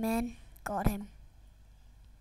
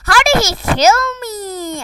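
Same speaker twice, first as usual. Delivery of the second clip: loud, higher, slow exasperated cry; frustrated outcry; 10 semitones higher, 12 dB louder than usual. Real, not acted. Gamer killed unfairly, loud frustrated shout. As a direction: yell it at the screen after dying.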